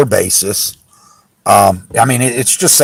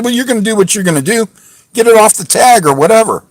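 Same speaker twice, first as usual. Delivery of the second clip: quick, higher, dismissive brush-off; defiant retort; 8 semitones higher than usual. Retold or imitated. Retelling what he told the officer, fast and defiant. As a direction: rattle it off like you're done arguing.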